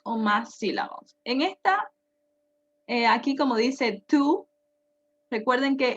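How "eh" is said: ordinary delivery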